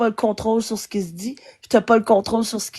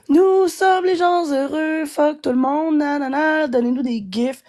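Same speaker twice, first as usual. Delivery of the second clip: mocking imitation; 5 semitones higher, slower than usual. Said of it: "t'as passé ta journée à dire".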